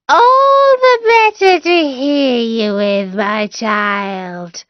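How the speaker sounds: the wolf straining to sound like grandma; hammed-up high granny voice, slow; wolf as grandmother.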